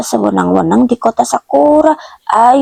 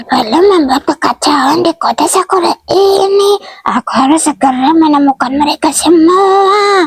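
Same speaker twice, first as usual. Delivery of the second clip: higher-pitched worried character voice; panicked prison patroller; 6 semitones higher than usual. Acted. Patroller finds the anomali prison empty, panicked. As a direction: play a dismayed guard fretting, voice lifted.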